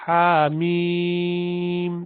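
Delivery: slow, chanted tajweed recitation; Quran recitation. Acted. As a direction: recite slowly with long drawn vowels. Recites again.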